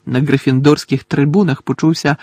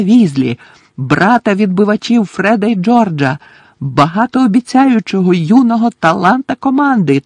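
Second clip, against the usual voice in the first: higher play-by-play building to a cheer; commentator, excited; 4 semitones higher, 4 dB louder than usual. Acted. Commentator introduces the new keeper and roots for him, excited. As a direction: hype the young keeper, then urge him on.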